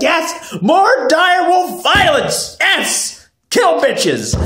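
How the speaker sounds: high, hyped-up whooping shout; mock bloodthirsty cheer. Fan cheering the wolf attack, high excited shout.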